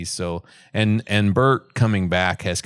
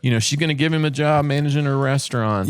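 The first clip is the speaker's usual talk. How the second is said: mocking optimist; 7 semitones higher than usual. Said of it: "you're like".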